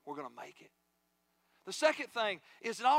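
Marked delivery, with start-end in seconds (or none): 0.0-0.7 very soft and low, as if into an ear; hushed, intimate comfort; gentle whispered reassurance. Acted. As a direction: murmur it tenderly, voice dropped right down. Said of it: "He whispers in your ear".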